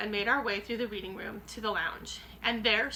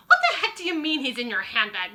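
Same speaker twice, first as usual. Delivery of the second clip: loud, higher, incredulous shout; 5 semitones higher, 8 dB louder than usual. Acted. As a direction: bark it out in disbelief. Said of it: "Mister Jenkins shouted".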